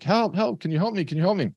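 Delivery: high-pitched pleading for help; mock cry for help. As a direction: call up for rescue in a high put-on voice. Man in hole calls to passer-by.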